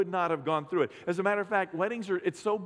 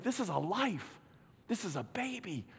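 hushed wonder; 3 semitones higher, 6 dB quieter than usual. Retold or imitated. Father meeting his newborn, in soft hushed reverence. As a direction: speak it like a held breath over a newborn.

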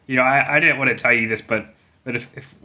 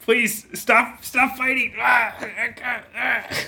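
much higher, strained cries of a scuffle; mock struggle; 11 semitones higher, much slower than usual. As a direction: yelp as if wrestling a suspect. Mock struggle arresting the man.

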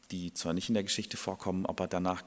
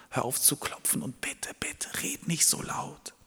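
urgent whisper; 5 semitones higher, 5 dB quieter than usual. Acted. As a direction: hiss at him to keep it down. Woken friend hushing at the door.

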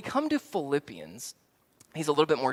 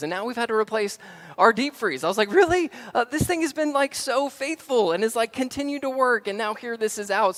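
high, loud mock outrage at the freezer; incredulous griping, pitched high and loud; exasperated disbelief; 8 semitones higher, 5 dB louder than usual. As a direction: throw up your hands, really?. Exasperated retelling of freezer dying.

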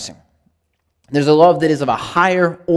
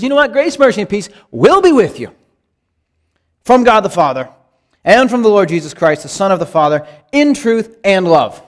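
higher and louder, a firm declaration; authoritative; 4 semitones higher, 4 dB louder than usual. Retold or imitated. Old apostle John blessing the church, bold and certain. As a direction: pronounce the blessing like it's settled fact. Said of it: "you can just be authoritative".

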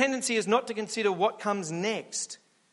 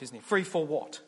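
normal talking voice

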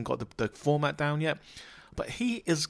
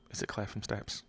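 whispered guess; 6 semitones lower, 11 dB quieter than usual. Pretend.

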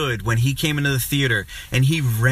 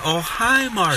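Tommy Wiseau impression; 7 semitones higher, slower than usual. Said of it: "I meant to start with".

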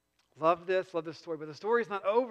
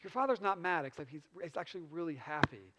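hurried, louder nervous rambling; nervous stammering servant; 4 semitones higher, 5 dB louder than usual. Retold or imitated. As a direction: babble the news while backing away. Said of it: "backing away".

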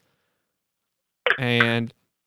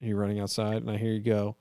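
usual speaking voice

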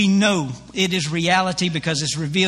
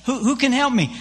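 higher-pitched questioning of an unseen voice; startled climber; 6 semitones higher than usual. Acted. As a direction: act out the stranded man, pitched up. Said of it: "the man's like".

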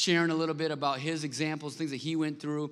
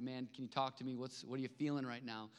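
gentle dad; 4 semitones lower, 11 dB quieter than usual. Retold or imitated. Dad gently asks crushed son.

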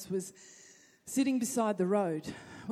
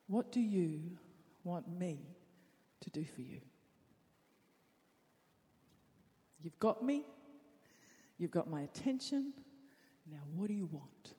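gentle question; 4 semitones lower, 7 dB quieter than usual. Acted. Jesus asks the beggar.

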